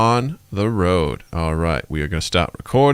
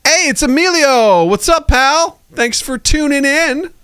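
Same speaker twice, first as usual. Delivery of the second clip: podcast host greeting; 3 dB louder than usual. Acted.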